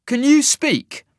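high, loud, slow demand; demanding challenge. A narrator's reading. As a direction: challenge the giant, loud and drawn out. Cole challenges the silent giant.

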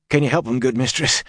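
hoarse pleading question. Acted. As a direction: rasp it out anxiously. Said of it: "he asked hoarsely".

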